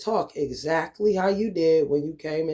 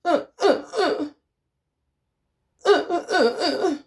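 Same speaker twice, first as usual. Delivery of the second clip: high mocking grunts; teasing high-pitched mimicry; 10 semitones higher than usual. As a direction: squeak out the sounds in a put-on voice. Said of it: "What happened to this voice?".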